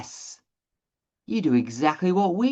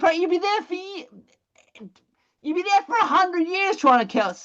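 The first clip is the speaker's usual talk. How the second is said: much higher, strained exasperation; exasperated high pitch. Acted. To the class about selling alone: strained high exasperation. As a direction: sound worn out by the idea. Exaggerating a hopeless chore.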